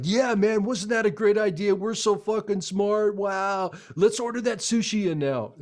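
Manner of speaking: giddy self-satisfied bro voice; high-fiving ad guys. Retold or imitated. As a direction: crow like dim clients congratulating themselves. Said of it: "literally high-fiving, like".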